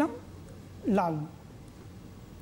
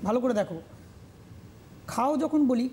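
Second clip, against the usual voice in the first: ordinary talk, nothing marked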